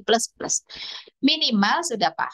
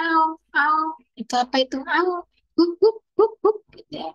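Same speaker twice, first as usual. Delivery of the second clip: put-on toddler dog barking; 8 semitones higher than usual. Shown as a demonstration. Teacher showing parents how a toddler imitates a dog, high barking. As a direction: bark like a little kid pretending to be a dog. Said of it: "sudah mulai menirukan suara".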